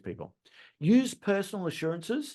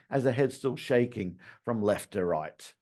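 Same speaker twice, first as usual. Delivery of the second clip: normal talking voice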